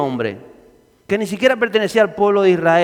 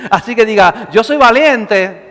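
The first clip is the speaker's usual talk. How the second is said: preaching at full force; 6 dB louder than usual. Real, not acted. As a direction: rouse the congregation to repeat it. Preacher calls out to congregation.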